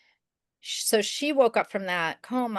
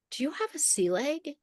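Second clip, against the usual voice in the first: high, hopeful, lightly breathless question; eager question; 8 semitones higher, 4 dB quieter than usual. Retold or imitated. Mother spotting a young amputee, asking her eagerly. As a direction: pitch it up in excited hope.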